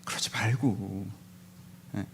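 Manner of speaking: unvoiced slow whisper; hushed aside to the congregation. Real, not acted. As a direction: breathe it quietly aside.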